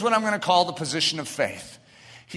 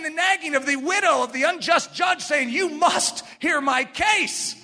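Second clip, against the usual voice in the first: impassioned preaching; 5 semitones higher than usual. Real, not acted. Preacher on the nagging widow and judge, impassioned preaching. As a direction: drive each repeat harder, pitched up.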